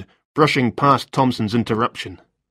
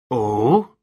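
interested. Acted. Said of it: "Thompson sounded interested".

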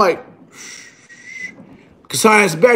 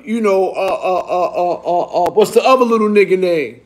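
ordinary delivery, nothing marked

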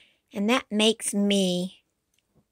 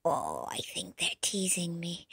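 soft, lower whisper; 5 semitones lower, 7 dB quieter than usual. Acted. Yoshi whispering to the child that they tease him. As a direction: confide a suspicion under the breath.

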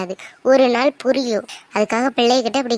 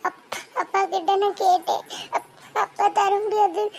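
sobbing; 6 semitones higher than usual. Acted. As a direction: sob out the whole story to her brother. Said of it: "தேம்பி தேம்பி அழுதுட்டு இருக்க". (தேம்பி தேம்பி அழுதபடி)

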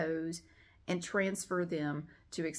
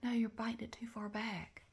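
breathy unvoiced whisper; hushed, gently scolding the pet; whisper to cat; 4 semitones higher, 8 dB quieter than usual. Real, not acted. Telling the cat she bites the tape wrong, whispered.

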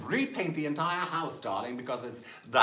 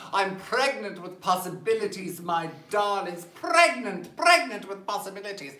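declaimed higher and louder, leaning on pregnant; grand dramatic pronouncement; 5 semitones higher, 4 dB louder than usual. Retold or imitated. Diva voicing her thought while staring at the camera, grandly proclaimed. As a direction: proclaim it like a diva's revelation. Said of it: "I'm thinking".

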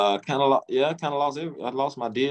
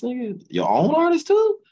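shocked boss voice, high, slowed and loud; incredulous boss; 6 dB louder than usual. Retold or imitated. Boss confronting him about sleeping with his artist, shocked and loud. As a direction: pitch jumps high and loud in disbelief.

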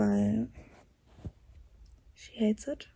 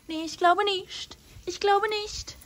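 higher, drawn-out playful denial; high, slow teasing; 10 semitones higher than usual. Acted. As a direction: stretch it out, teasing. Teasing dog about who peed.

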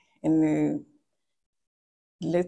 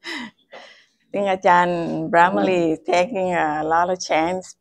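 ordinary talk, nothing marked

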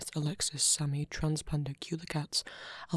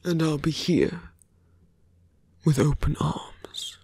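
villain voice; 16 dB louder than usual. Acted. Villain taunting the little hero before leaving, close menacing whisper. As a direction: toy with the hero like a smug villain, murmured close to the ear.

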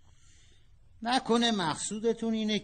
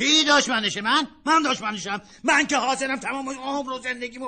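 higher, louder protest; indignant outburst; 9 semitones higher, 6 dB louder than usual. Acted. Father outraged at being called his daughter's enemy. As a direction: bristle at the accusation.

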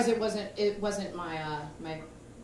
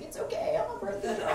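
much higher, whispery goofy voice; cartoon sign voice; 11 semitones higher than usual. Comic imagining a Wile E. Coyote sign, goofy high. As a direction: read it like a silly cartoon sign.

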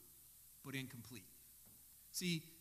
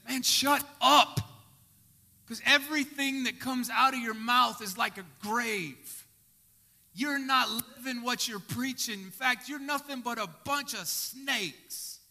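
angry rebuke; 2 semitones higher, 7 dB louder than usual. Pretend. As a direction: call them out with fire. Jesus rebuking the Pharisees.

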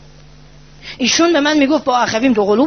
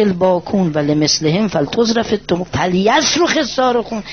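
slow, lower chanted Arabic recitation; prayer recitation; 4 semitones lower than usual. Acted. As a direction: intone the prayer slowly.

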